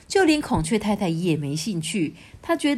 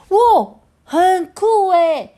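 much higher, louder, slow cry of wow; excited magpie; 12 semitones higher, 9 dB louder, much slower than usual. A narrator's reading. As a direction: gasp in delight like a magpie. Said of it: "喜鹊就说". (兴奋的喜鹊惊叹)

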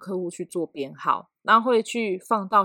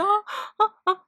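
mock anxious gasp; 13 semitones higher than usual. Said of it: "很焦虑这样".

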